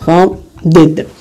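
فاوندد drawn out slowly, each syllable separate; slow model pronunciation. Shown as a demonstration. Teacher to students drilling the ending, slow and clear. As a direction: say فاوندد slowly so students hear the final d sound. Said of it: "دونك حنقراها فاوندد".

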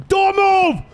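high, loud cop yell; shouting command. Acted. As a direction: yell it at the suspect. Cop yelling at suspect.

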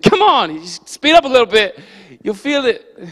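loud, high coaxing shout; calling out. Retold or imitated. Pastor coaxing family to draft behind him, loud encouraging call. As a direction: urge them on loudly and eagerly. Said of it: "I'm like".

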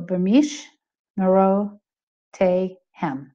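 B'mishmerotehem broken slowly into separate syllables. Shown as a demonstration. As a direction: stop and say each syllable of B'mishmerotehem as a whole. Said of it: "they're gonna stop and go".

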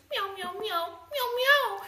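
rising little kitten meows; cat meowing. Acted. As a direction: meow like a small cat. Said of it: "and he goes".

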